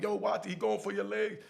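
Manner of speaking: high-pitched sideline call; called-out warning. Retold or imitated. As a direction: warn your fighting friend in a high voice. Calling out to fighting friend.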